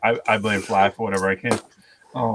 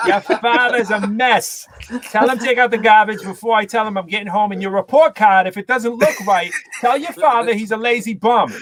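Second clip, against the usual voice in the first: loud, slow, heavy Boston mother shouting; mother yelling at her kid, thick Boston accent; Boston mom accent; 10 semitones higher, 4 dB louder than usual. Retold or imitated. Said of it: "do the Boston accent".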